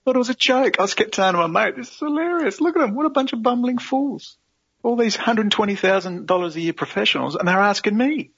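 high-pitched, scoffing disbelief; incredulous amusement. Retold or imitated. Telling his mate about bumbling professionals, amused and incredulous. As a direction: squeal it in mock amazement at fools. Recalls laughing at bumbling officials.

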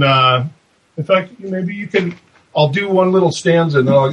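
normal talking voice

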